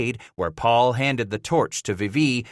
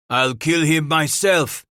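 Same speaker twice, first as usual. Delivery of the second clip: slow, raised boast; 6 semitones higher, 4 dB louder than usual. Acted. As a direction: brag it slowly with swagger. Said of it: "Emile Vivi boasted".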